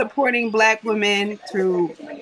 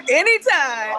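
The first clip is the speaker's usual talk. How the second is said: laughing; 8 semitones higher, slower than usual. Real, not acted. Laughs at compliment.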